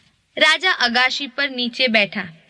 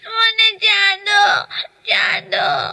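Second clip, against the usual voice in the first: put-on high, slow, sobbing child's wail; crying child; 9 semitones higher, slower than usual. A narrator's reading. Teacher voicing baby Ram wailing for the moon, high slow sobbing. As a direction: whimper for the moon like a tearful toddler. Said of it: "રડવા લાગ્યા ને જીદ કરી".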